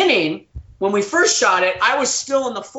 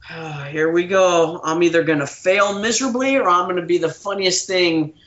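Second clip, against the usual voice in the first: resigned; 4 semitones lower than usual. Retold or imitated. Resigned inner thought.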